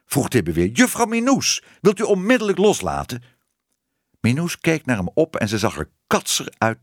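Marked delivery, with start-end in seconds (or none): 0.7-3.2 high, urgent reprimand; indignant order. A narrator's reading. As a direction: demand it sharply, rushing the end. Tibbe orders Minoes to let go. (verontwaardigd, hoog bevel)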